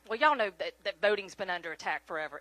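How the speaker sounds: ordinary talk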